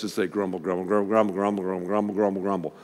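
low, soft mock grumble mumbled on and on; leading the congregation in a low grumbling chant; grumbling demo. Shown as a demonstration. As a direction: grumble grumble under your breath like a sulky crowd. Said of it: "just kinda do like this".

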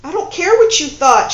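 loud, high angry snap, stress on what; snapping. Acted. Woman cutting off her lover, loud angry snap. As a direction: cut him off sharply in anger. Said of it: "she snapped".

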